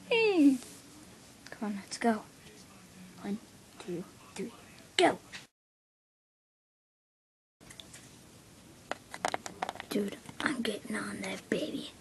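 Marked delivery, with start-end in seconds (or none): 1.5-11.9 hushed whisper. Acted. To his friend before jumping on, a whisper. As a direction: whisper the countdown. Sneaking countdown.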